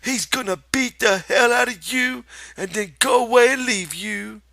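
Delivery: high, loud cruel taunt; taunting rabbit. Acted. The rabbit threatens Lenny, loud high taunt. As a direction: twist the knife.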